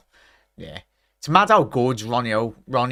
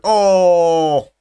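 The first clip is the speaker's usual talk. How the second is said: sharp loud higher cry; shocked oh; 7 semitones higher, 11 dB louder than usual. Real, not acted. Fan seeing the captain hurt, loud shocked cry. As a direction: gasp out at the injury. Coleman goes down injured.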